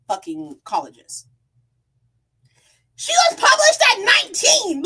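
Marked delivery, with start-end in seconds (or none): 2.9-4.8 much higher, louder outburst of disbelief; incredulous shout. Real, not acted. Defending the author to viewers, shouting in disbelief. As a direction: yell it in outraged amazement.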